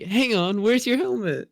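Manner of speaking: put-on high little kid voice; Bluey voice. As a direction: play the small kid, high. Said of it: "And then Bluey's like".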